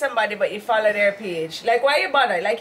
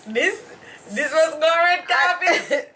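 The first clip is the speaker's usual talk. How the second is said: laughing; 6 semitones higher, slower than usual. Real, not acted. Teasing, laughing.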